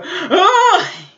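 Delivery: high loud burst; excited shriek. Real, not acted. Excited shriek as an idol appears. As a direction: shriek in delight. Sudden reaction to scene.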